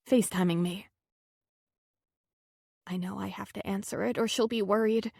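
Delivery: plain reading voice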